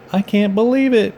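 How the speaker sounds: high, loud delighted laugh through words; Woody laughing at the goldfish. A narrator's reading. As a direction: laugh it out in disbelief. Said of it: "Woody laughed".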